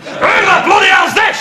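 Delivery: high bellowing shout; angry shout. Acted. Flatmate Brian bursting in on Nathan and Gladys, shouting. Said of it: "she shouts".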